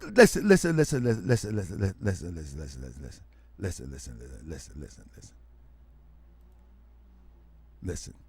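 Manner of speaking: repeated listen fading to a murmur; chanted listen dying away. Acted.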